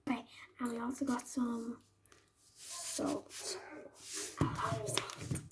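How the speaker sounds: hushed whisper so mom can't hear. Real, not acted. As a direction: whisper it secretly. Hiding the sauces from mom.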